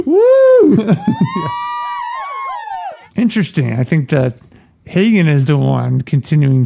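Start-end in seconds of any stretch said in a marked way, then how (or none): 0.1-2.9 very high laughing shriek; laughing whoop. Real, not acted. Reader whooping with laughter after the line.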